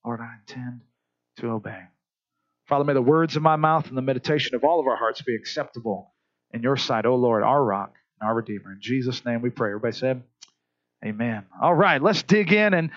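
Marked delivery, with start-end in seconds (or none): 0.0-1.8 low hushed vow, the repeat softer; quiet prayer. Shown as a demonstration. Pastor models a whispered vow of obedience, hushed. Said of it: "then say this". 2.6-11.5 prayer. Real, not acted. Closing prayer.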